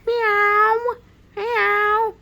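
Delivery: two high, loud imitated meows; cat meows. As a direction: squeal out separate cat meows. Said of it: "What kind of cat is that".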